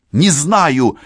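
loud, high, impatient retort; irritated. A narrator's reading. Policewoman answering her partner's question, irritated. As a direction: answer with annoyance, raising pitch and volume. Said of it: "раздражённо ответила Францискович".

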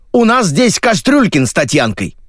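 high-pitched, excited update; reporting briskly. A narrator's reading. Editor updates the crew by radio, high brisk report. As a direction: announce it over the radio. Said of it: "отрапортовала редактор".

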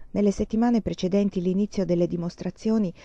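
usual speaking voice

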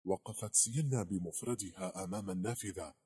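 ordinary delivery, nothing marked

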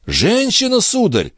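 high-pitched, anxious answer; nervous servant's reply; flustered servant. A narrator's reading. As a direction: answer like a porter under interrogation. Questioned porter answers flustered.

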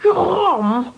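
shrill fright at a sudden intruder; startled. Acted. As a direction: caught off guard in the dark. Baroness startled by Jeroen at night.

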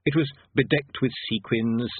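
ordinary delivery, nothing marked

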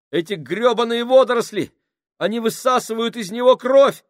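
very high, loud frantic scream; panicked screaming. A narrator's reading. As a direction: scream it in horror. Minhouse continues panicked shriek.

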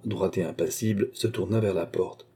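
plain reading voice

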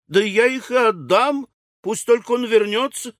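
high-pitched fervent promise; пылкая вдова. A narrator's reading. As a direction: promise it eagerly, voice soaring. Widow eagerly promises anything. (горячо, высоким голосом)

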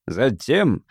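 usual speaking voice

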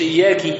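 إياكي with final kaf given a kasra, ending in "ki"; wrong vowel ending. Shown as a demonstration. Teacher showing a recitation mistake, إياكي with kasra on the kaf. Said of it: "بكسر الكاف".

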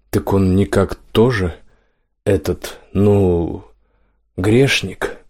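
halting, groping for the word; hesitant question. A narrator's reading. Гром backs away from the sleeper, asking hesitantly. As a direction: ask haltingly, with pauses before the key word.

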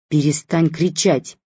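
ordinary delivery, nothing marked

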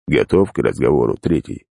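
low, lifeless rasping voice; dead inhuman voice. Acted. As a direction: speak like something dead. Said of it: "мёртвого и нечеловеческого голоса".

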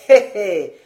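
loud smug hé hé again; knowing chuckle. Acted. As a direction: tease him with a self-satisfied laugh. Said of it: "fit encore Lapierre". (petit rire suffisant et sonore)

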